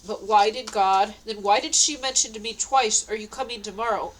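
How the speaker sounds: normal talking voice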